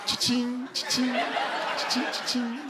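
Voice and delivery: high, slow, airy cha-ching; cash register cha-ching. Pretend. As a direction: chant it like a register ringing. Cash register sound effect.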